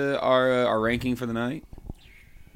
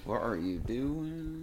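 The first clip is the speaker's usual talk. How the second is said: goblin voice; 2 semitones higher, 9 dB quieter, much slower than usual. Shown as a demonstration. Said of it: "I've been working on my goblin voice".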